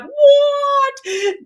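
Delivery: retelling the snowstorm, voicing the startled horses high and squeaky. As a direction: play the spooked horse asking what. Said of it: "the horses were like".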